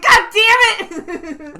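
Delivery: slow, loud, high frustrated curse through laughter; angry curse. Retold or imitated. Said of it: "I legit sounded angry".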